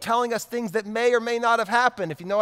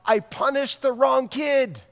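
horrified outburst; 4 semitones higher than usual. Shown as a demonstration. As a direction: shout it in slow dismay. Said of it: "you know what I'm talking about".

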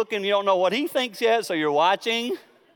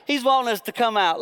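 put-on playful teen; 8 semitones higher, 3 dB louder than usual. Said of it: "and then, like".

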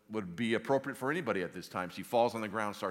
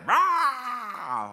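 very high, loud drawn-out yell; falling scream; 14 dB louder than usual. Acted. Preacher acting Eustace falling down the canyon, long scream. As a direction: scream all the way down the drop. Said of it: "Screaming the whole way".

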